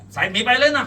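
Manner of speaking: high, loud piping up; volunteer testimony insistently; eager witness. A narrator's reading. Second witness to policeman, calling out eagerly.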